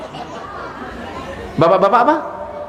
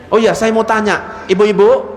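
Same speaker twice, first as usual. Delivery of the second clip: normal talking voice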